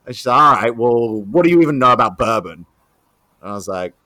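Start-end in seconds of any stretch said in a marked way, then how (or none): 0.4-2.7 loud, fast, dismissive challenge; grilling. Retold or imitated. Rep testing the green bar manager, grilling hard. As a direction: fire the question out as an unimpressed rep. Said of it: "she's grilling me like this".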